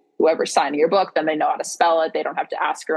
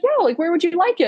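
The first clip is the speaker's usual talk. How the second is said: light high reply; 12 semitones higher than usual. Retold or imitated. Retold author's reply.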